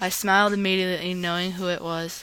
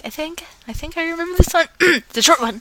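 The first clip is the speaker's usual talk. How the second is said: 1 excited; 9 semitones higher, 6 dB louder, faster than usual. Real, not acted. Excited recall.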